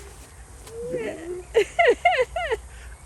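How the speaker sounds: put-on high, happy kid laughter; high delighted laugh. Boy laughs with delight over the fifty dollars, high and happy. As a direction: burst out laughing with joy.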